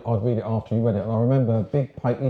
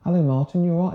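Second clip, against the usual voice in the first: higher, mock-friendly sarcastic greeting; 7 semitones higher, faster than usual. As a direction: greet him with a sneer in a raised pitch. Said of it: "he sort of sarcastically said".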